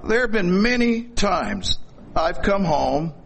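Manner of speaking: normal talking voice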